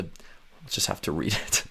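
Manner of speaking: a quick quiet aside to herself; hushed mutter of resignation; whispered aside. Real, not acted. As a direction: whisper it under the breath. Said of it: "whispered aside".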